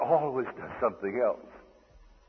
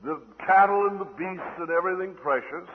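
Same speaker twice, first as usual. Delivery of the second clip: thundering command; 7 semitones higher, 9 dB louder than usual. Retold or imitated. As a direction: thunder out a scriptural order. Quotes a stern divine command.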